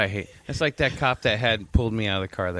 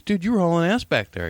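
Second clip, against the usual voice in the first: mock cop; 9 semitones higher than usual. Cop voiced in story.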